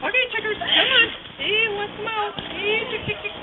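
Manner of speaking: high singsong chicken call. Real, not acted. Aunt calls chickens out of pen.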